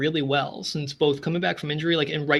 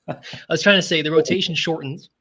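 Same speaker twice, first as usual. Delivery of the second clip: laughing; 2 semitones higher than usual. Real, not acted. Laughing at his own voice trouble.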